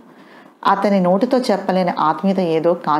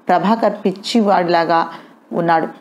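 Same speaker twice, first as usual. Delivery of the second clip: normal talking voice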